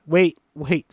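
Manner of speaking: loud, urgent cry to halt; panicked. Acted. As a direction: call out in sudden panic. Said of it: "Ruby panics".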